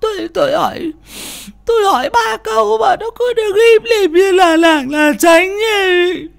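telling her husband about the ghost while sobbing; sobbing wife. A narrator's reading. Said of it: "trong tiếng khóc nức nở".

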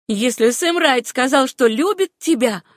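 pointed, measured spite; cold, calculated malice. A narrator's reading. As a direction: needle the rival coldly. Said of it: "начала Руфь с холодной, рассчитанной злобой".

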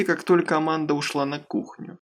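plain reading voice

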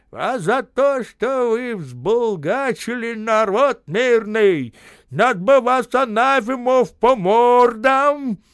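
put-on high, loud, slow old man's harangue; drawn-out, emphatic righteous scolding; old man's conviction. A narrator's reading. Old man over the narrator's ear, speaking with conviction. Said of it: "убеждённо говорит над моим ухом".

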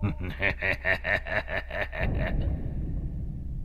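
low, breathy sinister laugh; evil demon cackle. A narrator's reading. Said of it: "Con quỷ đầu đàn lên tiếng".

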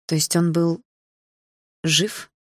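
hesitant, with a long pause midway; cautious. A narrator's reading. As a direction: feel your way carefully to the question. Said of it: "осторожно спросила я". (осторожно, с паузой)

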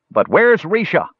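high-pitched, pressing repeat of a demand; insistent. A narrator's reading. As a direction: insist on an answer. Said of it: "insisted the elf at the back of the mob".

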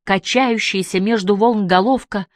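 plain reading voice

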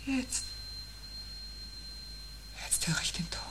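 soft, fearful whisper; whispering in fear as the tone arrives; frightened whisper. Acted. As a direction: barely voice it as the dread arrives. First hearing the dreaded tone.

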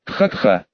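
low rough cough. Acted. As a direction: cough weakly, hurt. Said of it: "Ячуи закашлялся".

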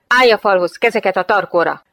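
loud, sharp command; shouted police order. Acted. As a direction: order them up against the wall, loudly. Armed officer orders someone to the wall. (hangos, parancsoló rendőri felszólítás)

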